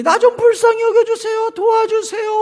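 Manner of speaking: hammed-up desperate praying; mock wailing plea. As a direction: beg like a crying child in prayer. Imitating wailing prayer.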